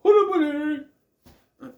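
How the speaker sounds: high, loud theatrical wizard call; a hammed-up sky wizard calling down; booming wizard. Wizard voice returns.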